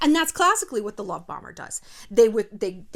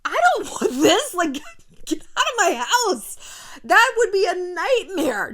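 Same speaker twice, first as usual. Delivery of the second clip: higher, louder, slow and laughing; laughing disbelief; 7 semitones higher, 6 dB louder, slower than usual. Real, not acted. Host laughing at the thought of a partner asking permission. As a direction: laugh off the idea of a clingy partner.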